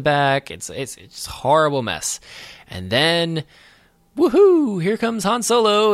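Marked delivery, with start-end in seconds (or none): 4.2-5.9 high, loud mock whoop; triumphant cheer. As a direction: whoop as the rescuer swoops in. Han Solo's triumphant rescue retold.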